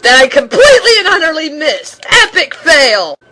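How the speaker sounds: loud, fast cry of dismay; exasperated yelp at a missed jump; frustrated outburst. Real, not acted. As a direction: yell at your own failed jump. Reacts to missing a jump.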